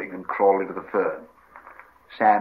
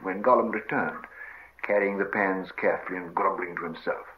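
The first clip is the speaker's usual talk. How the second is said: plain reading voice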